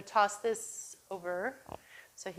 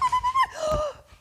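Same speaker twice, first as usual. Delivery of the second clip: excited gasp; 7 dB louder than usual. Shown as a demonstration. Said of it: "get him ginned up and excited".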